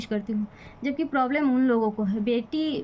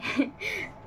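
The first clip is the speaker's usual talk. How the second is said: short laugh; 5 semitones higher, 5 dB quieter than usual. Real, not acted. Laughs at her own jibe.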